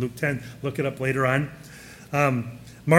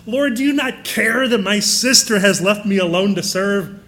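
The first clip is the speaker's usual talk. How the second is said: loud, exasperated outburst; blurted out; 4 semitones higher, 6 dB louder than usual. Acted. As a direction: burst out at the Lord in exasperation. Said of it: "she finally blurted out to Jesus".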